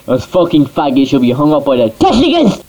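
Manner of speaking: ordinary talk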